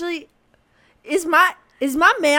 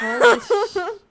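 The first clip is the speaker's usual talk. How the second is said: laughing; 9 semitones higher than usual. Real, not acted. Laughing shock.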